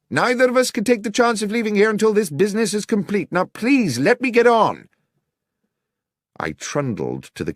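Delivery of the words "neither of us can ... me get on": higher, crisp detective voice dismissing Watson; brisk refusal, keep him at bay; Holmes, curt and firm. A narrator's reading. Holmes telling Watson to leave him be, brisk and high.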